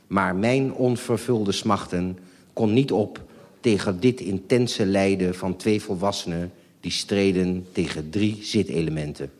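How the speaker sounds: usual speaking voice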